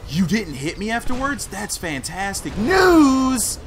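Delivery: high, loud, drawn-out last word; sarcastic outcry. Real, not acted. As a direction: wail the good news sarcastically. Sarcastic outcry after being hit.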